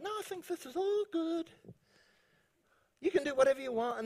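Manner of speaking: high, light voice waving it through; mock permissive voice. Tempter saying it's all good, in a high mock-reassuring voice. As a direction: pitch it up, softly, like someone excusing everything. Voices the doubting reply to scripture.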